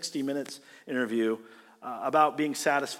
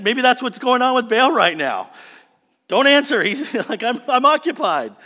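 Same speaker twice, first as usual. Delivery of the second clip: louder, higher joking voice with a laugh; mock toilet excuse; 6 semitones higher, 8 dB louder than usual. Preacher joking that Baal is on the toilet, laughing. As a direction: play it as a silly bathroom joke. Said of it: "You just think".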